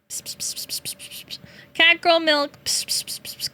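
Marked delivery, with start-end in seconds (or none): 0.1-1.4 unvoiced psst psst to lure a cat; whispered psst calls. Calling cats with cat milk. 2.6-3.5 unvoiced psst psst to lure a cat; whispered psst calls. Calling cats.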